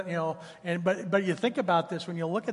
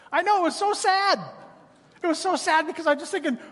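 pitched up high, hammed-up pity; half-joking grief, high and a bit louder; mock-sad lament; 10 semitones higher, 6 dB louder than usual. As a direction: wail it like a sorry child. Grieving dove, exaggerated.

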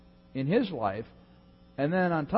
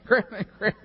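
laughing it out, pitched up; a laughing aside; 8 semitones higher, 4 dB louder, faster than usual. Real, not acted. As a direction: giggle through the words. Laughing at calling grandparents.

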